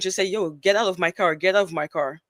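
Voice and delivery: ordinary delivery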